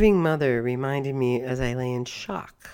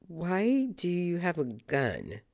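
stammered question; 6 semitones higher, slower than usual. Retold or imitated. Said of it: "I stammered".

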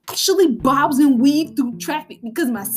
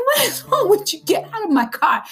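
high, exasperated what is wrong with you; fed-up snap; 9 semitones higher than usual. Retold or imitated. Mom mimics snapping at a nosy cop, high-pitched. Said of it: "I'm like".

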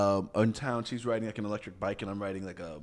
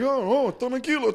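high mock cop soothing; 12 semitones higher than usual. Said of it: "he's like".

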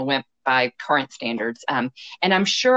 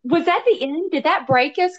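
high, worried questions; suddenly alarmed, asking much higher; alarmed surprise; 12 semitones higher than usual. Real, not acted. Friend suddenly fears the story's ending.

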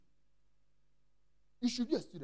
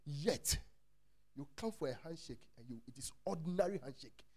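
normal talking voice

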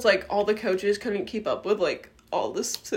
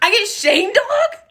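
higher and louder, outraged disbelief; mock indignant; 10 semitones higher, 6 dB louder than usual. Girl complaining her sister got the cuter nickname, mock indignant. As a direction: throw it back like a wronged six-year-old. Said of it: "So upset".

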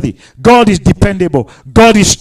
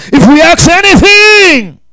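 higher, louder, slower cry of the promise; slow, loud preacher's cry; preaching shout; 7 semitones higher, 5 dB louder, slower than usual. Acted. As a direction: proclaim it to the whole church. Preaching climax, shout heard.

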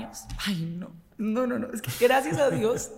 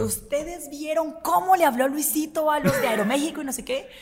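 pitched-up, eager gossip opener; gossip-host excitement; 6 semitones higher than usual. Acted. Host dishing celebrity gossip to her listeners, acted excitement. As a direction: act out breaking juicy news. Imita cómo contaba un chisme al aire.

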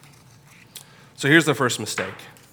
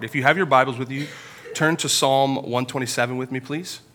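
ordinary talk, nothing marked

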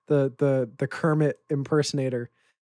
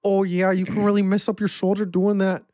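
bad Irish-sounding accent, a little higher; Paddy Pimblett impression; 4 semitones higher, 3 dB louder than usual. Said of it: "a perfect Paddy impression".

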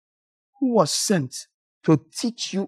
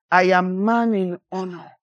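ordinary talk

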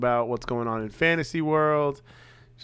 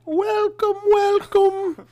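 very high put-on old-lady voice; Mrs. Doubtfire voice; 5 dB louder, much slower than usual. Co-host mimicking the accent, sounding like Mrs. Doubtfire. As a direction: squeak out a welcome like Mrs. Doubtfire. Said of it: "Sound like Miss Doubtfire".

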